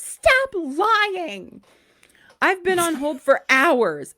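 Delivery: high, loud mock screech; screeching caller. Caller on hold for hours screeches at the agent, high and loud. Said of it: "the caller screeches".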